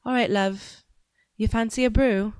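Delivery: playful northern English accent, lightly done; northern British accent. Shown as a demonstration. Second try for the chat, northern British accent. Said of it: "so a northern accent".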